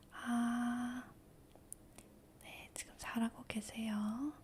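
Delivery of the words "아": demo ah sound. Shown as a demonstration. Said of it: "한번 소리 내볼게요".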